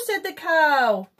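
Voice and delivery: plain reading voice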